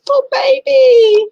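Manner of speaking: put-on very high, loud, slow wailing "Poor baby"; mock crying baby voice. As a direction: wail it like a pitying sob. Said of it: "always makes me go".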